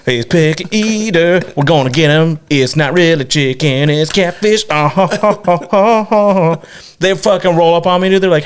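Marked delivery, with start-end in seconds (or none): 0.0-4.6 higher, gleeful conspiratorial voice; scheming relatives. Retold or imitated. Relatives plotting against the picky kid, gleeful higher voice. 4.7-6.6 higher hammed-up cackle; mock gloating laugh. Relatives gloating over the trick, hammed-up higher laugh.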